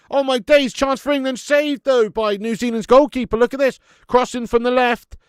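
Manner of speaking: loud, high, excited football commentary; animated watchalong shouting; live play-by-play. Real, not acted.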